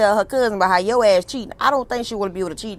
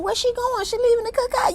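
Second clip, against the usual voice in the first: much higher, puzzled asking; surprised cast members, lightly voiced; mock surprise. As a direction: sound baffled she's leaving the party. Voicing cast's surprise.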